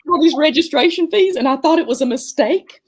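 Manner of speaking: high, loud, laugh-broken words; laughing through it. Real, not acted. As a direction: laugh while telling the story. Retelling crying over money, laughing.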